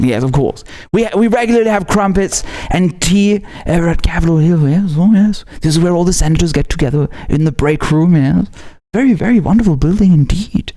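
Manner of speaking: mock posh British. Mocking senators over tea in a mild posh British accent. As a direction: sneer it in a light stuffy British accent.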